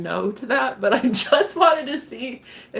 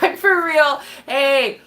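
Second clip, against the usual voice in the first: ordinary delivery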